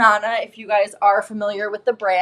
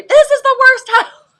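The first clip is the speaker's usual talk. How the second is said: high, loud yell breaking into laughter; hammed-up kid's shriek, cracking up; imitated child's scream; 14 semitones higher, 7 dB louder than usual. Son screaming heard from the driveway, imitated with laughter. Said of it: "screaming that".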